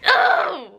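a sharp, high groan of disgust; disgusted ugh. Real, not acted. Fed up with the snarky lady, a sharp high disgusted ugh.

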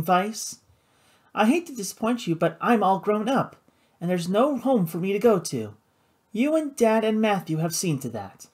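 high, pointed feminine voice; bitter sister. A narrator's reading. Flora telling her brother she's grown up, bitter. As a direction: push back at him, pitched high.

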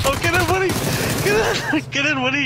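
excited cheer. Real, not acted. Cheering teammate's kill.

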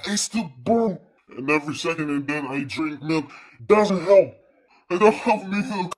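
loud, high, distressed complaint; loud pained complaining. Real, not acted. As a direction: cry out against the burn. Mouth burning, complaining loudly.

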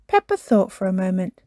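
plain reading voice

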